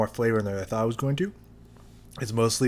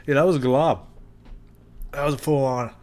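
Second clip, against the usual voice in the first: emphatic; 4 semitones higher, 4 dB louder than usual. Real, not acted. Emphatic confirming the glob.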